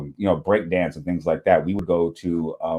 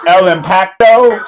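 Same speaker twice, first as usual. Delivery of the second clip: high, loud, slow El impacto? with a rolled dramatic stress; mock Spanish drama voice; 10 semitones higher, 10 dB louder, slower than usual. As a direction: stretch El impacto? out like a telenovela line. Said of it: "I gotta hear the Spanish".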